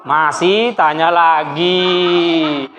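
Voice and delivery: low, very drawn-out words; weary exasperation. Real, not acted. Teacher to pupils who keep asking questions, exasperated drawl. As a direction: stretch it out in resigned complaint.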